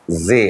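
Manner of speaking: "زے" said alone as a letter; letter sound demo. Shown as a demonstration. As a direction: pronounce "زے" by itself, low. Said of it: "زے کے تلفظ کو ادا کرتی ہے". ("زے" کا الگ تلفظ)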